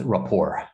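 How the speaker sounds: RAPPORT said as ruh-POR; RAPPORT stressed on the end, T silent; silent final T. Shown as a demonstration. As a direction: drop the T from RAPPORT. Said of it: "So the T is silent".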